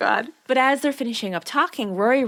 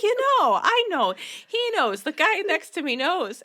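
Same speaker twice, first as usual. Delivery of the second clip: much higher, giggly hinting voice; exaggerated hinting; 9 semitones higher than usual. Lorelai hinting to Suki, high and giggly. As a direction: squeal the obvious hint, barely holding in a laugh.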